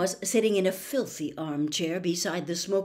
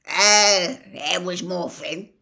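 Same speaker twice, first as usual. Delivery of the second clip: indifferent; 7 dB louder, slower than usual. Acted. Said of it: "said the old man indifferently".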